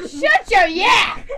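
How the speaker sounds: slow shouted scold, playfully stern; hammed-up shout at the kitty; mock scolding shout. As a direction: bark it at a naughty kitten.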